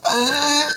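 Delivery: high, loud climax "Ah!"; mock climax cry. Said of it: "it just says".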